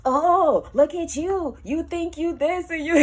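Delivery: loud, high sneering taunt; mock her to her face. Ex taunts her outside the gig, loud and sneering.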